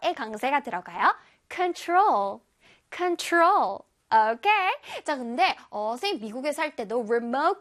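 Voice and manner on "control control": control with the final TROL stressed. Shown as a demonstration. Teacher drills children on control, stressing TROL. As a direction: push the ending TROL of control, higher. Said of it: "control은 끝에 T R O".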